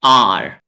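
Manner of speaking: आर said loud, a long aa with a strong curled r; model R sound. Shown as a demonstration. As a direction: say आर for the class to repeat. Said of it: "तीन बार मैं बोलूंगा".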